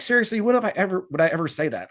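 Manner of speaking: high-pitched incredulous questioning, then dropping; retold frustration. Replying to scammed followers, frustrated and incredulous. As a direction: protest in mild disbelief. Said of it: "it just gets frustrating".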